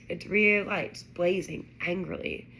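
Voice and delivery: usual speaking voice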